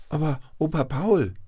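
put-on high, bright child voice calling grandpa; a little grandchild asking; child's plea. A narrator's reading. Grandchild asking grandpa about the forge, high child voice. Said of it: "fragten seine Enkelkinder".

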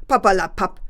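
loud dismissive growl; wolf snarling at the fox; angry wolf growl. A narrator's reading. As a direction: brush the fox off angrily. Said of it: "knurrte der Wolf böse".

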